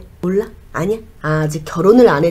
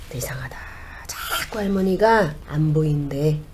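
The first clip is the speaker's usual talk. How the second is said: eerie whisper; 5 dB quieter than usual. Acted. Shaman senses something odd.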